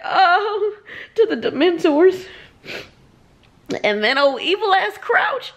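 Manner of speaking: real tearful crying, voice high and wobbling; crying through the words, pitch way up; crying while reading. Real, not acted. Reader crying over the begging son scene, tearful and high. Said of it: "I'm about to cry".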